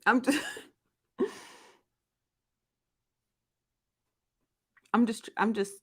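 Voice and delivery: breathless laughing fragments; laughing, can't finish. Real, not acted. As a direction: trail off giggling. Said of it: "trying not to laugh".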